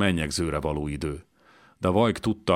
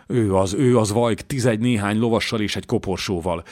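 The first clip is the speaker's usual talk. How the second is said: louder, higher, gasping out the news; panting scout blurting out what he saw; breathless urgent report; 4 semitones higher, 5 dB louder than usual. Acted. Rados, out of breath, reporting to Vajk urgently. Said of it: "Fújtatott, lihegett".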